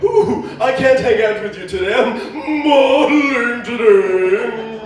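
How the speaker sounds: put-on posh airs, last word drawn out; mock posh. Model turning down a hangout, hammed-up posh. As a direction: boast in an affected posh voice, stretching it slowly. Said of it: "It sounds so posh".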